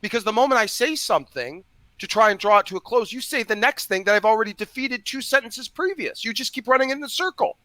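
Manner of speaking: fed-up debater; frustrated. Real, not acted. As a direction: pitch climbing in exasperation. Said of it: "I'm getting frustrated".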